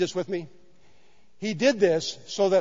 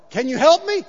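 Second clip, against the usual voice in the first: frantic man; 10 semitones higher, 7 dB louder than usual. Retold or imitated. Man on cliff pleads.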